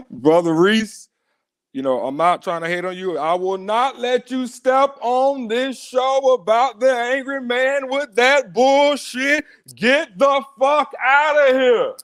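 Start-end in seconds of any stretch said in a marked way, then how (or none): 3.2-11.9 higher, slow, furious dismissal; slow, rising scolding; angry rant. Real, not acted. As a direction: throw the caller out, raising pitch. Host angrily kicks out caller.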